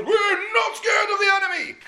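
high, loud, slow chant; rallying shout. Retold or imitated. General hyping the army, high loud shout. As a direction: pump the crowd with a big yell. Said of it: "And all the soldiers".

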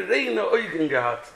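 usual speaking voice

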